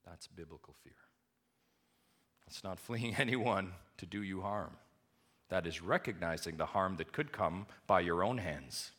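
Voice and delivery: normal talking voice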